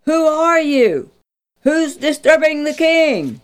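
the captain calls out into the dark; startled call. Acted. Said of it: "he called".

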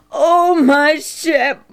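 slow, loud, high acted weeping; crying. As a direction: draw the name out in grief. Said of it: "Mark cried".

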